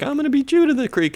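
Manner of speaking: fast, high falsetto; mock racing kid. Kid challenging the other on bikes, fast mock falsetto. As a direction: rattle off a kid's challenge in falsetto. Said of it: "they're like".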